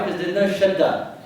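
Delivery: ordinary talk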